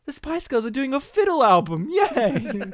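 high, excited mock fangirl; hammed-up girlish glee; foolish teenage girl. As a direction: squeal it like a thrilled teen. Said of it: "some foolish teenage girls like".

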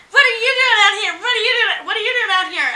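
high, loud mock shrieking demand; mock outrage from the other girls; mock angry girls. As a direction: play the jealous girls.

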